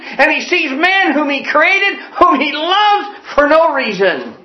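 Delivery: rising fervor, every never hammered home; full-force preaching. Real, not acted. Pastor preaching to his congregation about God's love, at full force. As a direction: preach it high and ringing to the congregation.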